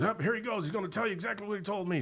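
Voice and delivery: high-pitched, rapid-fire heckling; mock yelling. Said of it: "this other guy's, like, yelling at him".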